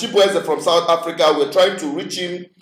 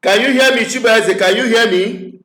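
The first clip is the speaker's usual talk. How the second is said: higher, louder straining to be heard; to someone hard of hearing; 5 semitones higher, 4 dB louder than usual. Real, not acted. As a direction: call out over a dead line. Caller cannot hear him.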